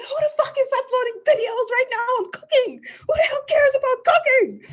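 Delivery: furious high shriek at a cooking video; screaming at phone. Retold or imitated. Woman retelling screaming at her phone over a cooking upload, furious high shriek. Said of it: "I was literally screaming at my phone".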